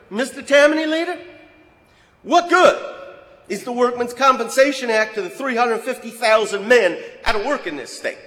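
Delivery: sneering challenge. Retold or imitated. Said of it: "Pratt was not a very nice man".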